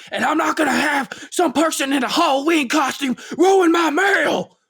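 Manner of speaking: high, loud outraged yell; furious shout. Retold or imitated. Imitating a pundit on a coworker's clip, high loud outraged yell. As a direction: blast out the outrage. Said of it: "he's like".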